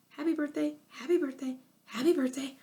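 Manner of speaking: high repeated parrot squawk; nervous parrot chatter. A narrator's reading. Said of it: "in a nervous chatter".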